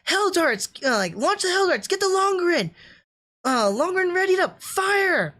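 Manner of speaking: high, urgent shouted commands; hammed-up anime battle call; imitating bridge commands. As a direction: call out orders like a ship's officer. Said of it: "when they're calling".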